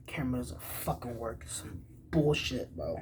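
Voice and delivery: soft whispered mockery of her excuse; disbelieving whispered sneer; scoffing whisper. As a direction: scoff it under your breath.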